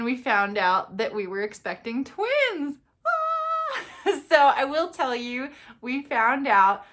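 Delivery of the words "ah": very high delighted shriek; excited squeal. Real, not acted. Revealing twins to viewers, excited squeal. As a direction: squeal with joy.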